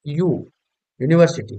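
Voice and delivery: "यू" said as a clear "yoo" leading into "यूनिवर्सिटी"; the "यू" of "यूनिवर्सिटी" voiced as "yoo", like a consonant; yoo sound demo. Shown as a demonstration. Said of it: "इसको यू नहीं बोलेंगे".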